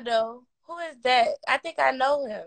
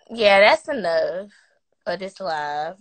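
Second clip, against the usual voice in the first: ordinary talk, nothing marked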